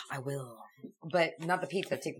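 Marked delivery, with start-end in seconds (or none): 0.1-0.6 whisper. Real, not acted. Sighing whisper.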